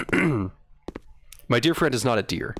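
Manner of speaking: slow, deliberate "dear" then "deer", words drawn out; joking stress on dear and deer. Shown as a demonstration. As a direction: lean slowly on "dear" and "deer" like a pun. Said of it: "D, D E A R".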